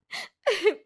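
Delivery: high wailing sob, forced tears; loud fake sobbing. Caught cheating, Ritu wails fake tears at her friend. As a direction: burst into put-on crying to dodge blame. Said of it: "जोर जोर से रोने लगती है".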